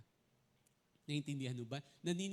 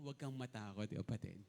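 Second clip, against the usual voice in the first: softer, lower Huwag kang matakot, kapatid to a neighbor; a soft word of comfort to a fellow churchgoer; gentle reassurance; 10 semitones lower, 4 dB quieter than usual. Shown as a demonstration. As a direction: say it quietly to the person beside you. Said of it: "Sabi mo sa katabi mo".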